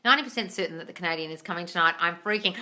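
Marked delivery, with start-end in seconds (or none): none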